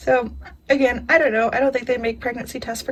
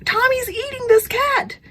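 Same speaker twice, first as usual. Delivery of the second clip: high, urgent call across the house; yelling for her husband in alarm; retold yell. Calling to husband Kerwin, high and alarmed. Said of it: "I yelled at Kerwin".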